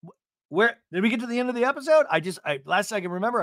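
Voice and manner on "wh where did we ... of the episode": loud startled jolt awake; mock waking up. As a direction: jerk awake, loud and fast. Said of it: "I was hearing Teddi's voice, and I just conked out".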